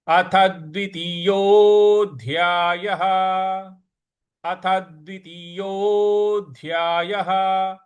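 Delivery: अथ द्वितीयोऽध्यायः drawn out, यो held long; slow recitation. Shown as a demonstration. Teacher models the chapter heading. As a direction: recite each syllable slowly. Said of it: "इस प्रकार से इसको बोला जाएगा".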